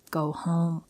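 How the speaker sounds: usual speaking voice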